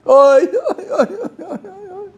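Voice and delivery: high wailing oy cries; sobbing. Retold or imitated. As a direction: wail like a broken man. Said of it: "broke out sobbing".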